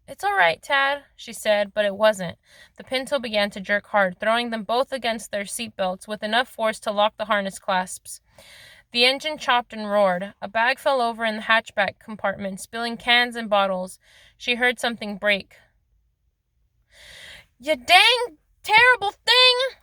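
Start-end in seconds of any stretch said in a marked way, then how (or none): 0.1-1.0 higher-pitched hasty reassurance; reassuring. A narrator's reading. Mother answers her alarmed boy in the car, reassuring. 17.6-19.8 loud, high, drawn-out furious cry; exasperated fury. A narrator's reading. Driver screams at her failing car, in exasperated fury. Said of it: "she cried in an exasperated fury".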